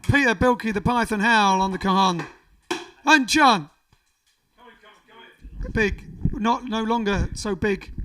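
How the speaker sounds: big emcee build to the last name; stage announcer. Acted. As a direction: call out each player to applause. Introduces the band members.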